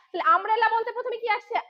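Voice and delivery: usual speaking voice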